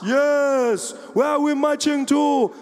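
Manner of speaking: declaiming party-leader shout; Julius Malema. Retold or imitated. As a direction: call out to riled-up supporters. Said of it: "Every day he was there".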